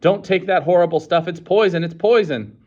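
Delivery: high, loud warning cry; urgent warning. A narrator's reading. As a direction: plead with them to stop. Savage warns the crowd.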